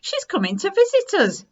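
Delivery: put-on high, loud child's shout; excited shout. A narrator's reading. Second child shouting about Grandma's visit, excited. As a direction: call it out like thrilled news. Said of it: "shouted the other".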